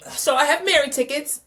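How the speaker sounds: high, loud, thrilled announcement; excited. Real, not acted. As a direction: crow it with real excitement. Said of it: "I'm so excited".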